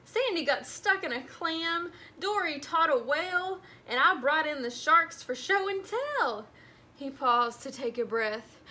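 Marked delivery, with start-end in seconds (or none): 0.0-6.5 higher, eager rush of news; excited kid. A narrator's reading. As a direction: tumble out the news. Nemo excitedly telling dad.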